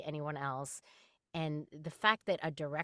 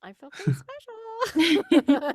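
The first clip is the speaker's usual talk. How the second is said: high, slow, loud boast; playful gloating; 12 dB louder, slower than usual. Pretend. Guest shows off her rare Lego, high gleeful drawl. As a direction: draw it out high and proud, playfully.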